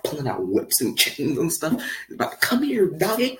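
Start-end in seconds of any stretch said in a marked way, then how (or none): 2.4-3.4 high, slow coaxing call; mock dog catcher. Dog catcher luring a dog into the van, sly high.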